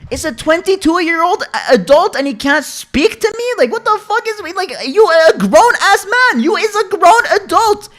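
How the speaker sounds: high, loud, screechy exasperation; indignant rant. Retold or imitated. Son rants that grown brother won't talk, indignant yelling. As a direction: yell it in high-pitched disbelief.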